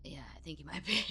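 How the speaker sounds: whispered, breath-only reply; soft whisper, no voice; hushed agreement. Acted. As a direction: whisper it confidingly. Plays Rekid privately agreeing after ruse.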